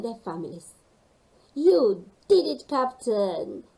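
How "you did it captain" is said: proud cheer. Acted. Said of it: "said Rosie".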